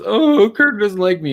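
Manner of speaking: mock whining. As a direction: mimic a sulking baby who feels snubbed. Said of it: "these two crying about Kirk".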